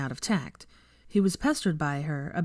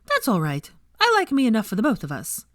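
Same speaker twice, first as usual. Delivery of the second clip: sweetly; 5 semitones higher, 5 dB louder than usual. A narrator's reading. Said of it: "Veronica said sweetly".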